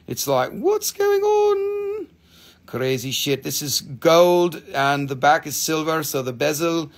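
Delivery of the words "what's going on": very high, long-drawn exclamation; mock amazed call. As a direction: stretch the last word out in a high held note. Acts out amazement at the pendant.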